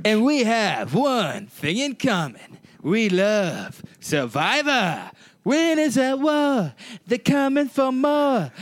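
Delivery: slow, theatrical announcer build-up; epic show intro. Acted. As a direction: sell it like a blockbuster trailer. Chanted dramatic show intro.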